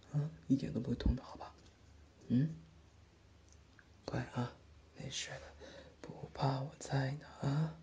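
soft comforting whisper; ASMR whisper. Acted. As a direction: hush her fear while spraying the wound. Comforting during treatment.

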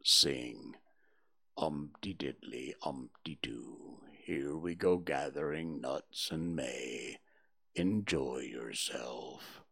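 very low, slow and mournful Eeyore; gloomy donkey. A narrator's reading. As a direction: drag it out like a sad old donkey. Eeyore mocks the song.

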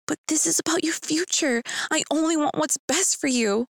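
a mother's high worried plea; lightly voiced anxious mom begging her child; mom crying out. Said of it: "My mom cried out".

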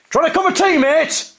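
high, loud holler up the stairs. Retold or imitated. Calling a builder upstairs, a loud high holler. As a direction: bellow it up to someone upstairs. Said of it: "I holler up the stairs".

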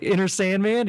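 put-on shy kid voice. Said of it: "he's like".

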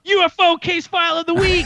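host introducing the weekly UFO segment to the podcast listeners, hammed-up announcer voice. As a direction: boom it out like a radio jingle intro.

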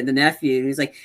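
ordinary delivery

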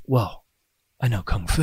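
low, soft, deadpan movie-hero "Whoa"; Keanu Reeves impression. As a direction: lightly mimic a stunned action hero. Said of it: "That was my Keanu impression".

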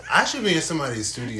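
fast and loud through laughter; laughing. Real, not acted. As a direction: laugh it out, hyped up. Laughing hype.

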